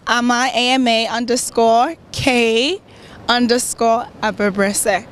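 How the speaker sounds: slow, spaced-out spelling of the handle; slowly. Shown as a demonstration. Guest repeating her Twitter handle for viewers, slowly. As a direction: spell it out unhurriedly, pausing before each underscore. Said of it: "just say it slowly for the peeps".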